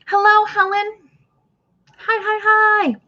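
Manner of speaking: high, slow, drawn-out hello; sing-song greeting. Real, not acted. Host greeting a viewer in chat, high sing-song.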